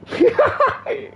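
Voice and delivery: squeaky high burst of laughter. Real, not acted. Laughing at his own gamer impression, high squeaky laugh. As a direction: crack up high.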